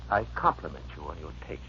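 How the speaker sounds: quiet, sneering contempt; low scornful sneer. Acted. Heathcliff sneers at Linton.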